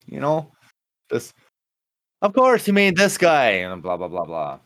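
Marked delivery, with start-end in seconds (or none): 2.2-3.5 high, loud put-on voice, smarmy and sure; mock Valentine impression; mimicking Valentine. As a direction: play the smooth talker who knows everyone. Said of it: "sounding like what a Valentine would sound like".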